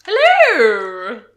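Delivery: loud, wild shopkeeper greeting; crazy shopkeeper voice. Acted. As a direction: bellow it like an unhinged shopkeeper. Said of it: "that crazy shopkeeper voice".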